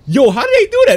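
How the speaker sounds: high, loud amazement; amazed exclaim. Real, not acted. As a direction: burst out in wonder. Amazed by trick.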